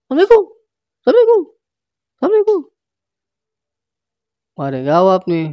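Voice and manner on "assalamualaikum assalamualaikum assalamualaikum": much higher, loud greeting called at the door; calling out. Acted. Rusman calling out at Doni's door: loud greeting. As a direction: call it out so the house hears.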